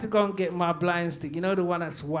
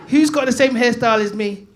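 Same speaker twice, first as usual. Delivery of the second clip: calling out; 5 semitones higher, 10 dB louder than usual. Real, not acted. Calling out to the crowd.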